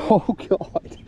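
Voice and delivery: ordinary talk, nothing marked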